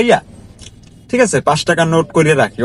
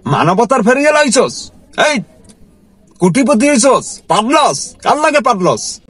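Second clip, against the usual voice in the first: high-pitched mocking scold, imitating the boss; retold angry boss; 7 semitones higher, slower than usual. Boss scolding his driver for giving alms, high mock-angry. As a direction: sneer the questions up high like a contemptuous boss. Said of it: "দিয়ে বলে".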